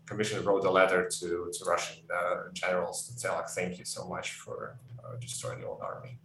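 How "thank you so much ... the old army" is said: hushed, mock-grateful thanks; sarcastic whisper. Ukrainian commission thanking Russian generals, sarcastic whisper. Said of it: "I can't tell if you were being sarcastic".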